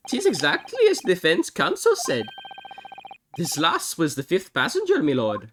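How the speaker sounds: hammed-up stage Irish accent; the worst Irish accent ever, played for laughs; bad Irish accent. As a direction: tell the tale as a cheery Irish witness. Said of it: "the worst Irish accent ever".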